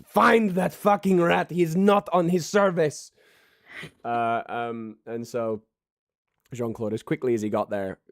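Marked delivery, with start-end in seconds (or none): 0.0-3.1 high, loud and slow order; angry chef. Acted. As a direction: hammer out the order to the assistant. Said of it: "He goes".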